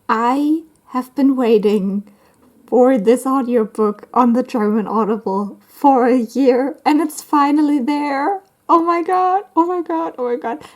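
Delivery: building to a high, thrilled squeal; can barely contain the excitement, pitch climbing; excited. Real, not acted. Vlogger tells viewers a long-awaited audiobook is out, excited. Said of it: "I'm so excited".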